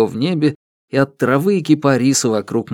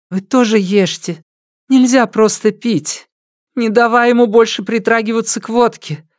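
higher voice for the wife; woman's voice; 11 semitones higher than usual. A narrator's reading. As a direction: voice her pleading gently. Woman's voice in audiobook.

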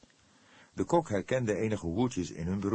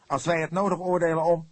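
much higher and louder, cutting; sharp retort; 11 semitones higher, 8 dB louder than usual. A narrator's reading. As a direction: snap back at him hard. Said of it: "de kok onderbrak hem". (scherp en luid, Vledder valt uit)

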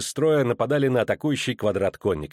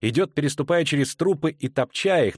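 usual speaking voice

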